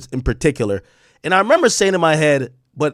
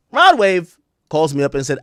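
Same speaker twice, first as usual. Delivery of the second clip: ordinary talk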